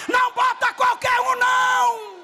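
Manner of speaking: loud, high, slow preaching shout; slow booming pulpit cry; preaching at full force. Acted. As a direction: drive each phrase at the congregation. Preaching climax.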